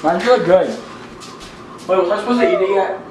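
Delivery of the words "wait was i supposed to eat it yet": usual speaking voice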